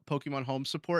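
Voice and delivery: normal talking voice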